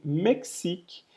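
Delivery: Mexique said slowly, the X as a ks sound; French Mexique. Shown as a demonstration. Teacher modelling the French word Mexique slowly for students. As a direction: say Mexique slowly, mek-sik. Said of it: "Esse X tem um som de X".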